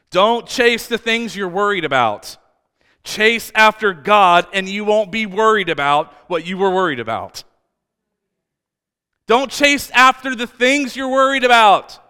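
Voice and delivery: loud, high, emphatic sermon delivery; full-force preaching. Real, not acted. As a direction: drive each phrase home to the congregation.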